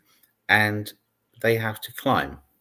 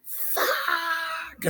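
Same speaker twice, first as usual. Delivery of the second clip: mock child's scream; 4 dB quieter than usual. Imitating eight-year-olds in Gorilla Tag, mock scream. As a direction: imitate a kid screeching, held back in volume. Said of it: "screaming at the top of their lungs".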